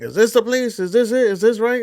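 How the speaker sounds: high, rising mock-anxious wife; imitating the wife's nervous doubt; wife's worried question. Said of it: "And my wife's like".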